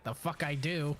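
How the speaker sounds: ordinary talk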